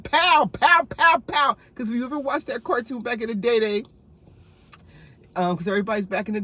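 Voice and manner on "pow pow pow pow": loud, high mock pow pow; gunshot noises. Imitating Yosemite Sam's guns, loud mock pow. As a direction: shoot like a cartoon cowboy.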